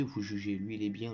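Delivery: usual speaking voice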